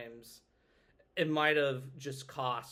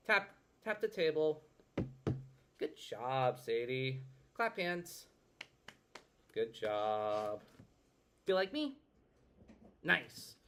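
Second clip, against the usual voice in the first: put-on reading to a toddler; 3 semitones higher than usual. Therapist prompting a child client.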